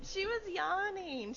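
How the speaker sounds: laughing through the words; giggling while she explains. Real, not acted. Laughing at the cat photo.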